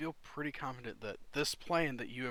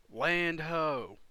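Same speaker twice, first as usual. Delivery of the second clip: loud, raised lookout's cry; yelled call; 4 semitones higher, 10 dB louder than usual. Acted. Crow's nest lookout yelling to the crew below, yelled call. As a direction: call it out across the ship. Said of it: "ho-, yelling out".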